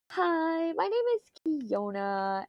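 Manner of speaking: high, slow, cutesy self-parody; mocking her own voice. Streamer imitating how she sounds to Miss Coffee, high and slow. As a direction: mimic how you think you sound on stream. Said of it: "it probably sounded like".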